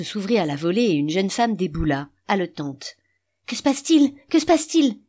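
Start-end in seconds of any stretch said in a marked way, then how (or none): 3.5-5.0 higher, louder panicked calling; alarmed cry. A narrator's reading. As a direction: burst in demanding what happened. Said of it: "cria-t-elle".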